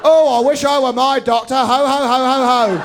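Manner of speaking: loud leering parody voice; hammy sitcom voice. Acted. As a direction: ham up the sitcom lech.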